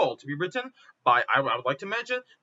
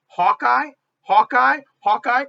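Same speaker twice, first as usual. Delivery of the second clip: loud, higher, punched repeats; incredulous; 4 semitones higher, 8 dB louder, slower than usual. Real, not acted. Fan arguing Hawkeye is not first-tier, incredulous. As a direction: throw the name out in disbelief. Scoffing at second-tier superhero.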